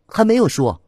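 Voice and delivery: high-pitched, impulsive outburst; blurted out. A narrator's reading. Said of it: "陈果脱口而出".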